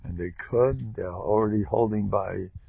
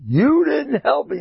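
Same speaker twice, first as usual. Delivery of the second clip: much higher, louder, slow whine; complaining to God; 8 dB louder, slower than usual. Retold or imitated. Person complaining to God, slow high whine. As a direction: plead like a wronged child. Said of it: "Don't pressure the Eibishter".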